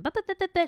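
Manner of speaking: high-pitched imitated yapping; mocking nonstop chatter. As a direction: babble like someone who won't stop talking. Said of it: "she's still talking".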